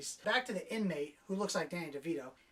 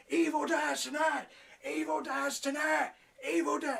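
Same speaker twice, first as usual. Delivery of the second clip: mock rallying shout; 7 semitones higher than usual. Negan rousing the mob at the hospital, shouting. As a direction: whip up an angry crowd, hammed-up. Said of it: "trying to ramp up this crowd".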